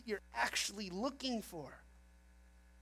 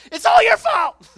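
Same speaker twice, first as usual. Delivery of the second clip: angry shout; 13 semitones higher, 20 dB louder than usual. Acted. Preacher voices angry spouse blaming.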